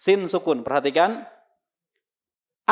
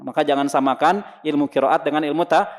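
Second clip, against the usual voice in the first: usual speaking voice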